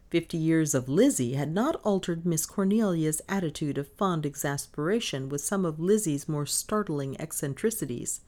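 ordinary delivery

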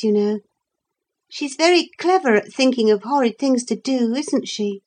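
put-on high-pitched, earnest child character; earnest child confessing. Figure of a child in story.

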